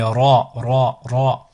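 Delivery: Ra with a rolled Spanish-style R. Shown as a demonstration. Teacher showing the Arabic Ra, R rolled as in Spanish. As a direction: roll the R at the start of Ra. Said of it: "Un R roulé".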